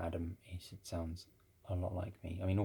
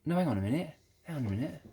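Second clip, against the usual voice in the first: high, loud mock protest; acted sudden realisation; 8 semitones higher, 6 dB louder, faster than usual. Retelling the phone mix-up, acted high-pitched realisation. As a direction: pitch jumps up, retelling a double take.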